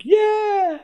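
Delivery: high, thin imitation of a crowd's yeah; mock crowd cheer. Imitating the crowd cheering, squeaky and high. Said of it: "the crowd behind are like".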